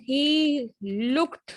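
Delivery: he, looked, back said one by one with gaps; words in isolation. Shown as a demonstration. Teacher showing separate words to learners, words in isolation. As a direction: pause between "he", "looked" and "back". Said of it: "पहले words को isolation में बोलना".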